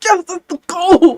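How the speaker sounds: high, loud, drawn-out celebratory cry; excited cheer. Retold or imitated. Coach hearing a student is having fun with language, excited cheer.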